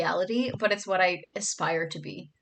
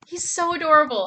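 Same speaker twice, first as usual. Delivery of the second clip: gushing; 8 semitones higher, 8 dB louder than usual. Real, not acted. Gushing over frog.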